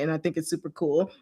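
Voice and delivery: ordinary delivery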